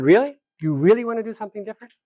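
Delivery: pointed disbelief at their claim; incredulous challenge. Retold or imitated. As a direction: press them on whether they mean it, quickly. Mediator challenging the group.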